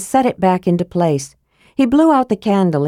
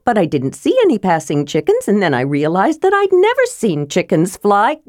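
higher-pitched, eager kender voice; chattering Tass; 3 semitones higher than usual. Acted. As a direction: rattle on with childlike curiosity.

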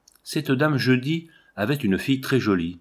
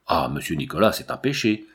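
surprised cry; 5 semitones lower than usual. A narrator's reading. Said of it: "s'écria Marguerite ... surprise".

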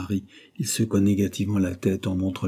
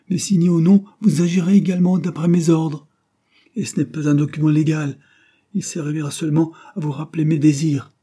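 higher, louder stern insistence; forceful; 8 semitones higher, 7 dB louder than usual. Acted. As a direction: overrule them sharply. Dying man insists forcefully.

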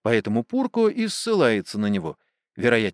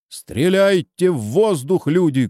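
shouted command; 7 semitones higher, 3 dB louder than usual. A narrator's reading. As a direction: call the order out to your men. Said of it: "кричит своим староста". (громкий приказ своим)

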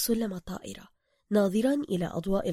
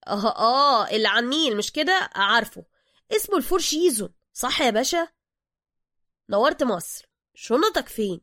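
pushy Cairo driver; 3 semitones higher, 6 dB louder than usual. Acted. Driver character voice.

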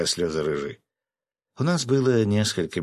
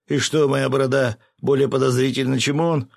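higher, louder challenge; indignant protest; 6 semitones higher, 5 dB louder than usual. A narrator's reading. Fisherman objecting about his beard, indignant protest. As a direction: pitch it up, loudly affronted.

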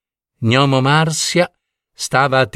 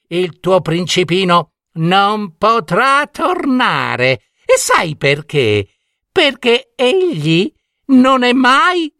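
high, mocking witch with cackling laughter; gloating witch; 11 semitones higher than usual. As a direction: taunt her, rising to a shrieked cackle. Witch mocks princess, cackling. (strega beffarda, risata acuta e malvagia)

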